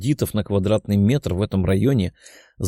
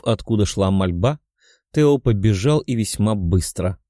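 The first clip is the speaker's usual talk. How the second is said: ordinary delivery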